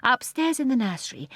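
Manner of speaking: ordinary delivery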